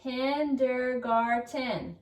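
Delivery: KINDERGARTEN stretched slowly, each syllable clear, run together; slow blended sounding-out. Shown as a demonstration. Teacher reads with pupils, KINDERGARTEN said slowly, syllables joined. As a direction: draw out KINDERGARTEN so a class can read along. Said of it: "Read this word with me".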